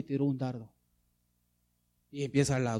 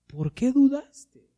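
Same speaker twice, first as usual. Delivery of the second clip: slow, high-pitched searching question; reproachful question; 11 semitones higher, 8 dB louder, much slower than usual. A narrator's reading. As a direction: stretch it out, questioning a doubter. Voicing Jesus's question to Peter.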